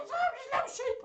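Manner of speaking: very high, squeaky puppet voice; high-pitched toy sheep squeal; Little Sheep. Pretend. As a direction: pipe up as a tiny excitable sheep. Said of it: "in Little Sheep's voice".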